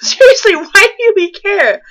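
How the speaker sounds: incredulous laughing complaint; exasperated mockery. Real, not acted. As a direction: ridicule a pointless detail. Mocking the fanfic's detail.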